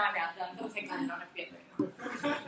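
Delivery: fast, dropped-off mock relief; relieved student speaker. Teacher imitating a student glad to leave the podium, quick mock relief. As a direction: rattle it off under the voice, mock relieved. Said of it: "People love to do that too".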